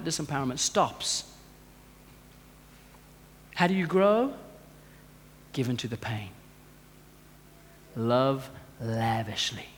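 low, soft, grave sermon voice; hushed preaching. Real, not acted. Preacher urging congregation to grow, hushed grave sincerity. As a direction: bring it right down, low and solemn with pauses.

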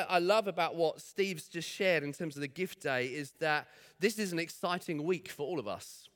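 ordinary talk

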